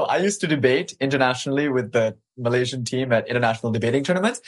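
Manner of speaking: normal talking voice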